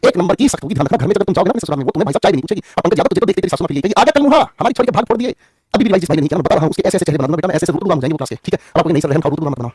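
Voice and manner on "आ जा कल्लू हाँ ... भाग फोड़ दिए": higher, scolding village mother-in-law; mock mother-in-law. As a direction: tease like a sharp-tongued saas. Mocks strict mother-in-law scolding. (चिढ़ाती सास की नकल, ऊँची आवाज़ में)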